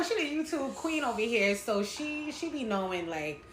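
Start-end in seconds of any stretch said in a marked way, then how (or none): none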